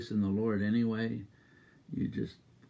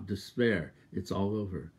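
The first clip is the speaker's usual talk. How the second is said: normal talking voice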